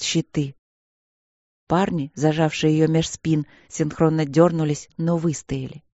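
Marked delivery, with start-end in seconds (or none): none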